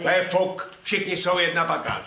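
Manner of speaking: contemptuous outburst. Acted. Husband sneers at the maid.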